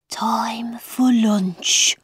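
slow, drawn-out whisper. A narrator's reading. March Hare echoing the Mad Hatter, whispering. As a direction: whisper it like an eerie echo. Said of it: "whispered the March Hare".

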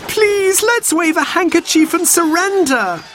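very high, desperate plea; frightened little girl pleading; begging. A narrator's reading. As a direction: beg the others to give up. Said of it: "begged Jane".

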